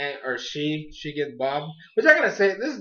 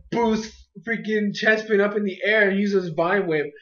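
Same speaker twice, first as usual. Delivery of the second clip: usual speaking voice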